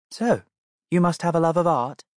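put-on a kind princess stooping to a child; cartoon princess. Princess asks William.

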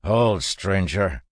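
loud, slow gruff command. A narrator's reading. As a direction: call out a halt like a rough guard. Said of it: "a gruff voice".

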